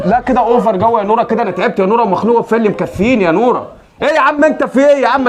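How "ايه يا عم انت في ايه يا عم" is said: exasperated yell. Acted. Uncle yelling at unruly kid.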